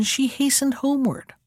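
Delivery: plain reading voice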